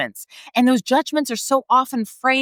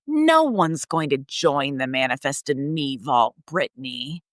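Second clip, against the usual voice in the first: condescending inner voice; 7 semitones lower than usual. Retold or imitated. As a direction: imitate the nasty voice in your head, cold and dismissive. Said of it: "The voice was so condescending".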